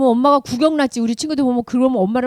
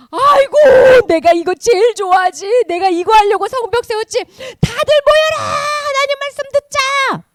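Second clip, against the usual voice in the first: high, loud, delighted outburst; excited calling out; 11 semitones higher, 8 dB louder than usual. Acted. Leader calling all the people to hear God's word, excited. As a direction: gather everyone with an excited shout.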